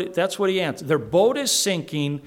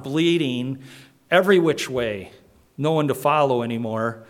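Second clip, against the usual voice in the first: ordinary delivery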